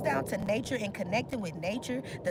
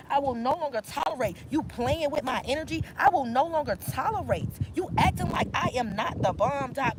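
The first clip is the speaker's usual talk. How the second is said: louder, forceful list of refusals; loud declaration; 5 dB louder than usual. Real, not acted. Reader declares she will tolerate no more, loudly. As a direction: proclaim each refusal firmly.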